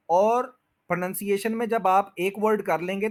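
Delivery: ordinary delivery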